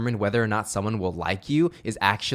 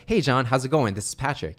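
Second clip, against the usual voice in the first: upbeat, energetic greeting; smiling, eager sales hello; positive voice; 3 semitones higher than usual. Shown as a demonstration. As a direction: open lifted and get them excited. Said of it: "The first one was".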